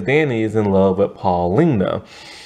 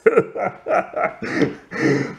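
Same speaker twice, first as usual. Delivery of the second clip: breathy laugh; 9 semitones higher, 3 dB quieter than usual. Real, not acted. Laughs at own scattered thoughts.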